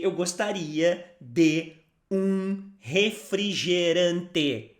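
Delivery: slowly, each syllable of "refrigerante" drawn out; slow careful. Shown as a demonstration. Teacher's slow model for learners. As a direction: spell the sentence out slowly. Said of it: "Now, faster".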